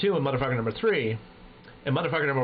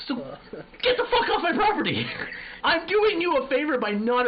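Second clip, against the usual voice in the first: mock yell; 11 semitones higher than usual. Yelling at crack smokers.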